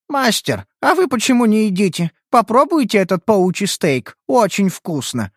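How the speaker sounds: high, eager offering; praising. Acted. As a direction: coax him to try it. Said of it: "похвалила Тайхо".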